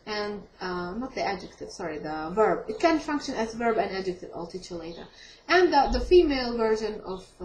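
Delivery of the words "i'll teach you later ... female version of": normal talking voice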